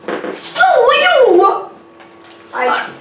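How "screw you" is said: high, swooping mock-Italian jeer; Mario accent. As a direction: taunt in a sing-song Mario accent. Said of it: "talking in your Mario accent".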